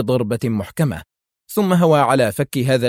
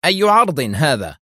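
higher, sharp question; 4 semitones higher, 4 dB louder than usual. A narrator's reading. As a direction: demand an answer, with some edge. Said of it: "سألها في حدة". (سؤال حاد)

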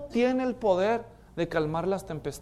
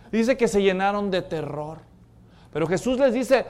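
usual speaking voice

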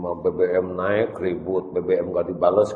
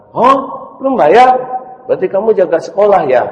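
much higher, louder calling teacher voice; high, loud teacher voice calling a pupil; bus teacher calling; 9 semitones higher, 8 dB louder, faster than usual. Acted. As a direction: call the boy onto the bus from the door. Teacher teases unpaid boy.

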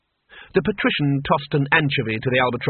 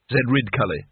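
ordinary delivery

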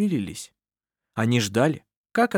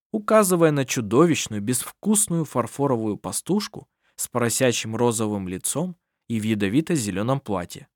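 plain reading voice

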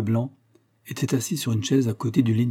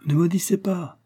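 higher-pitched exclaimed plea, not shouted; priest's raised-pitch exclamation; crying out; 8 semitones higher than usual. A narrator's reading. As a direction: cry out in protest without raising the volume. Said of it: "s'écria le prêtre".

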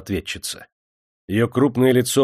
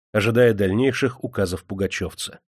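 plain reading voice

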